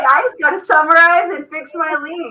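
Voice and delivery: high, loud, elated rush; excited celebration. Real, not acted. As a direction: keep cheering as you quote her. Still celebrating, voicing the student's ease.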